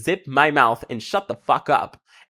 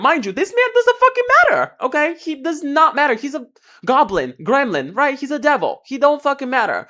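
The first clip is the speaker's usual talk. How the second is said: high-pitched rant; 9 semitones higher than usual. Real, not acted. Ranting about the man.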